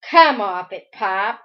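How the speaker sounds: loud, dismissive retort; cold. A narrator's reading. Possessed boy snaps back at father, cold. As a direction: brush him off coldly and forcefully. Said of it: "came the cold voice".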